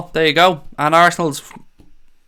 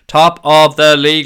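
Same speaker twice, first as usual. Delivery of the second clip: "Top of the league" louder and slower, leaning on "top"; drawn-out "Top of the league" boast; proud shout; 8 dB louder, slower than usual. Shown as a demonstration. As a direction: chant "Top of the league" slowly and loud. Repeats the chant louder.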